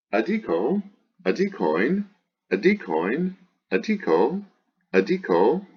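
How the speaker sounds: high singsong chant of word forms, each swinging up; paradigm recitation. Shown as a demonstration. Teacher reciting the declension, high singsong drill. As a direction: drill each form separately with an exaggerated pitch accent.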